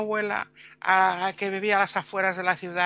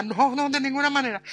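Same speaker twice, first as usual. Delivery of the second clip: panicked refusal; 7 semitones higher than usual. Retold or imitated.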